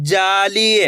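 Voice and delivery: ordinary talk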